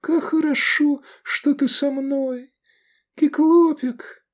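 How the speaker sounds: put-on very high, slow, fragile girl's voice; faint, affectionate, drawn-out words; tender whisper. A narrator's reading. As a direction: speak lovingly as if dying. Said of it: "прошептала она ласково".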